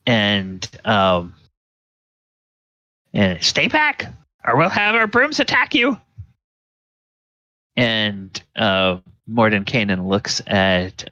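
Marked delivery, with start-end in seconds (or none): 3.4-6.0 very high, squeaky refusal; shrieky character voice without shouting; scolding witch. Acted. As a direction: shut down the demon in a tiny high voice. Woman threatens with attacking brooms.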